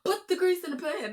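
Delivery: high, pushed mock holler; exaggerated high-pitched hollering; retold hollering. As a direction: holler it high, imitating a girl who can't hear herself. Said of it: "hooting and hollering at a girl".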